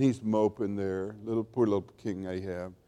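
low, soft mock sympathy; lightly mocking, dropped and hushed; mock pity. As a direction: sink into pretend pity. Mock pity for moping Ahab.

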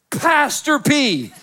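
high, slow drawn-out call; drunk greeting. Retold or imitated. Drunk man at the bar spots the pastor, high and drawn out. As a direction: stretch the name like a happy drunk. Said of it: "he went".

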